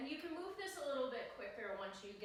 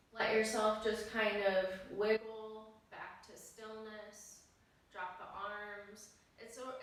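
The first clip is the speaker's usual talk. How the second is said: slow, unhurried cool-down guidance; soothing yoga teacher winding down; guided calm cue; 4 dB louder, slower than usual. Acted. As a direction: ease them back to stillness, slowly.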